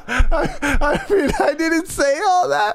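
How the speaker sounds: high-pitched, laughing denial; laughing protest. Real, not acted. Host denying the co-host's mock quote, laughing protest. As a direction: protest through helpless laughter.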